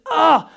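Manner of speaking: a sudden snapping yell; angry outburst. Shown as a demonstration. Preacher showing punishment, short mock angry yell. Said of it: "Punishment is".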